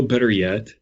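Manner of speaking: ordinary talk, nothing marked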